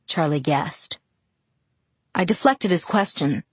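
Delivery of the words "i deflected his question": usual speaking voice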